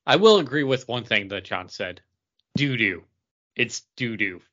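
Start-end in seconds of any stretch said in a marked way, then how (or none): none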